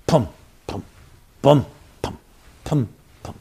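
soft rhythmic pum pum heartbeat thumps; imitated heartbeat. Rabbi imitating the heartbeat tape for listeners, soft thumps. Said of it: "A heartbeat is playing in the background".

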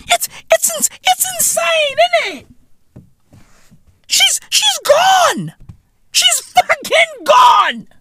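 shouting high with disbelief; high excited rant. Real, not acted. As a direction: yell it high, losing it. Ranting about the aunt's text.

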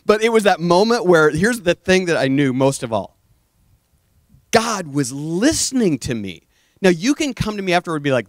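ordinary talk, nothing marked

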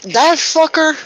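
sudden high squeal of disbelief; surprised. Real, not acted. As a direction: shriek it in shocked recognition. Shocked recognition of Nick Miller.

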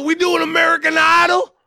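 high shouted Southern question. Retold or imitated. Retelling a singer's talk with [name], shouted Southern impression. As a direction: holler it in a mock Southern voice. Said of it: "Impersonating him?".

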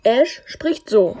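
loud slow character voice; slow, heavy, loud delivery; Ash voice. Shown as a demonstration. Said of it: "Ja, übelst cringe".

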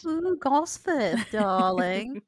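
drawn-out hammy posh drawl. Pretend. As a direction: camp it up like a posh local. Posh mock of Gosforth resident.

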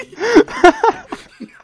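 sudden sputtering burst of laughter; spray water out laughing; spit take. Real, not acted. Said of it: "I just spit out my water".

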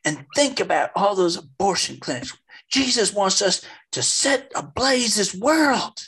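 climbing to full preacher's pitch; high, slow and fervent nun. Retold or imitated. Retelling the nun's most heated line, high and fervent. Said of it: "and she says".

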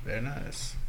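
quiet imitation of a comic catchphrase; hushed film-character impression; Borat. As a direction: softly slip into a mock foreign accent. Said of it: "speaking of accents".

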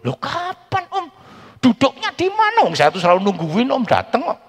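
high, loud incredulous questions; pressing him, voice up high; mock questioning. Pastor questions uncle in quote.

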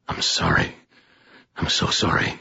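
hushed, broken-off near whisper; soft, low, remorseful murmur; stricken apology. A narrator's reading. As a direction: apologize under your breath, aghast. Stricken apology to wounded captain.

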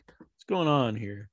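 whispered, conspiratorial aside; hushed whisper to the viewers. Acted. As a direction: lean in and whisper it. Mock suspicious aside.

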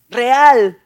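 "real" mucho más fuerte y alargado; énfasis en real. Real, not acted. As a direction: golpea la palabra "real" con fuerza. Predicador enfatiza la rebeldía. (predicador recalca "real" con fuerza)

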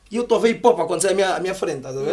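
usual speaking voice

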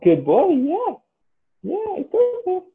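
very high sing-song voice for a dog; puppy voice. Real, not acted. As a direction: coo up high like talking to a puppy. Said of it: "This is [name]'s puppy voice".

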